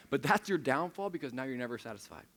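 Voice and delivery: ordinary talk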